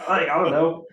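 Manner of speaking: laughing through the words. Real, not acted. Laughing at own joke.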